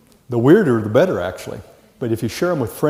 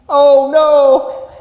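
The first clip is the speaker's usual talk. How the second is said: high, loud cry of mock dismay; 12 semitones higher, 8 dB louder than usual. Teacher reacting to a class that forgot, mock dismay. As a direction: throw up a hammed-up oh no.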